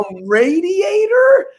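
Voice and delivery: radiator stretched as RAY-dee-ay-ter, rising high; slow, high, laboured long A in radiator; effortful pronunciation. Retold or imitated. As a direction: struggle through radiator as an incredulous question. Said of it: "look at my mouth trying to say it".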